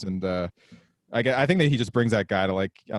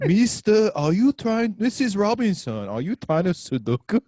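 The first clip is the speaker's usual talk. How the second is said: high-pitched put-on film character; hammed-up high voice easing down at the end; spoof seduction line; 6 semitones higher than usual. Podcaster spoofing Missus Robinson to his friend, put-on voice.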